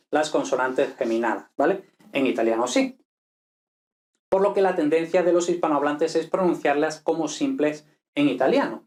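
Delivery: ordinary talk, nothing marked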